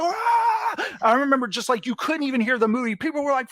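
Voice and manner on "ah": high shouted crowd cheer; crowd roar. Retold or imitated. Opening day audience roaring, high shouted cheer. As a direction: shout like a packed theater erupting. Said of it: "everyone was like".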